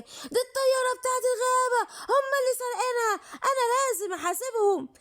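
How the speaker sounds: high, crying accusation; tearful outrage. Acted. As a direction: cry it out, hurt and shocked. Discovers the thieves.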